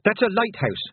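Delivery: high, urgent warning; mild panic. A narrator's reading. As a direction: make them understand the danger. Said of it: "what Tiffany felt was real, honest panic".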